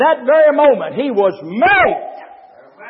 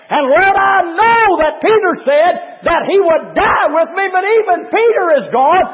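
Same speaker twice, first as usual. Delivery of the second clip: Jesus pleading to God; 4 semitones higher than usual. Acted. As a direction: cry out to God, more desperate each line. Christ's prayer voiced, pleading, rising.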